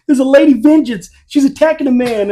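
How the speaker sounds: loud, high-pitched imitation of a New York accent; imitating a New York accent. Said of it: "[name] doing a New York accent".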